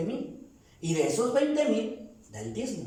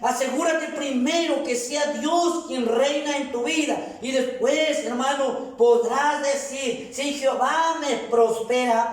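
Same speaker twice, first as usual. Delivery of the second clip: raised, high-pitched exhortation; preaching at full force; 5 semitones higher, 4 dB louder than usual. Real, not acted. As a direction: exhort the congregation with driving force.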